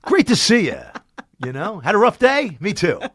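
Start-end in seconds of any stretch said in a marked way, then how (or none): 0.0-0.8 high, loud, put-on greeting; glazed-over cheer. Performer greeting an unimpressed party guest, glazed-over cheer. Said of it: "glaze over them, like". 1.8-3.0 high, loud, put-on greeting; glazed-over cheer. Performer greeting an unimpressed party guest, glazed-over cheer. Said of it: "glaze over them, like".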